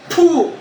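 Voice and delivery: loud, high "puh"; explosive puff of breath; ritual blowing puff. Real, not acted. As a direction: blow hard to drive the spirit out. Healer blows on the patient.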